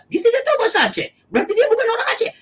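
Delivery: high, fast mock of the critics' logic; mocking critics. Pastor mimics critics who say he is not Acehnese, high and fast mockery. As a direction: rattle it off high as if it were absurd.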